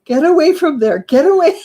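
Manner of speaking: mock yell at the dog; imitated yelling. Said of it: "we're always yelling at him".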